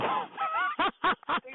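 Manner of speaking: high cackling laugh of triumph; gloating laugh. Real, not acted. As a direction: laugh like you just won. Gloating at the caller.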